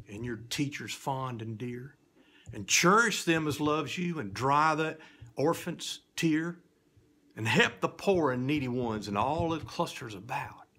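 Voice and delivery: spooky storyteller's reading, swinging from whisper to loud; theatrical recitation for children; dramatic poem recitation. A narrator's reading. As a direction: tell it like a fireside ghost tale.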